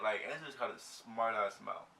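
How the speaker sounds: ordinary talk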